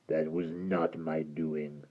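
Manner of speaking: deep gravelly doom; menacing creature pronouncing fate; low, slow creature verdict. Acted. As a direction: sentence them slowly and low. Creature dismisses.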